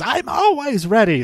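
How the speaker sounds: high-pitched retold comeback; hammed-up, high protest; mock indignant boast. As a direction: imitate a cocky comeback, pitch shooting up.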